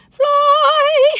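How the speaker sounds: high, loud cry of fly; cheering shout. Pretend. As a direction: shout it up like freeing a bird. Calling the bird to fly.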